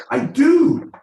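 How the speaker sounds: sudden high squeaky yelp; shrill-voiced burst of insistence; high squeak. Real, not acted. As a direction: jump way up high and loud.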